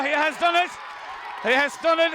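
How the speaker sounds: high excited yell of triumph; excited shout. Real, not acted. Commentator yelling as the point goes over, excited.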